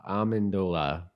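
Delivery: ordinary talk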